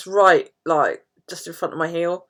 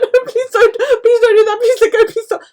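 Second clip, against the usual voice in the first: much higher, louder squealing plea; laughing pleading; 9 dB louder than usual. Retold or imitated. Begging the physio to stop, laughing plea. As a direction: beg through giggles. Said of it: "I'm like".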